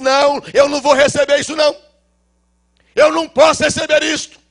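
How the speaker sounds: high, loud insistent no; loud refusal. Retold or imitated. Father loudly refusing to take back the money.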